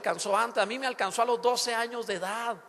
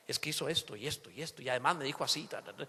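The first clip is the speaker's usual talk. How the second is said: muttered grudge rant; 9 semitones lower, 7 dB quieter, faster than usual. Retold or imitated. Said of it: "lo alimentan".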